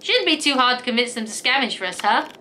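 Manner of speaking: ordinary talk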